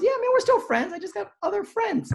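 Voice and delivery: high, squeaky take-off of a buddy; high-pitched mock friend. Friend brushing off an old pal, mock high voice.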